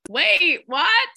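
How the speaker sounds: high, loud stunned "wait, what?"; a gasped squeal of disbelief; shocked disbelief. Retold or imitated. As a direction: blurt it out in sudden shock. Her rival's fire suddenly died.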